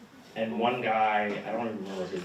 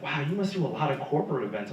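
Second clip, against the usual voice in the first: impressed wow; 6 semitones higher, 4 dB louder, faster than usual. Retold or imitated. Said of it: "she was like".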